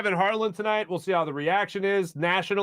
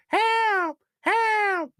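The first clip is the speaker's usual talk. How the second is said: peacock call. Retold or imitated. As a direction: screech like a panicked peacock. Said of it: "what Ben Simmons is saying tonight".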